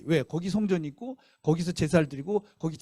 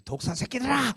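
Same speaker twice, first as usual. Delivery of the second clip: higher-pitched mock yell at a crowd; John the Baptist's retold shout at the priests, a higher mild mock yell; 8 semitones higher than usual. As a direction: call out like John scolding from afar. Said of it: "소리를 지르는 거예요".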